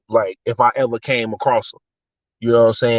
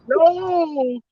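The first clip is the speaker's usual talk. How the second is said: high squeaky no. Retold or imitated. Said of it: "I'd have been".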